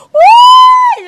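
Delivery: a sudden, very high, shrill falsetto shriek; ecstatic shriek. Acted. Vlogger shrieking with delight at her own beauty. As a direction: squeal it out like you can't contain yourself.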